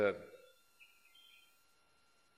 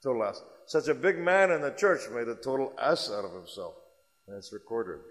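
ordinary delivery

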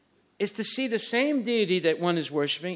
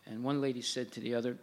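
ordinary talk

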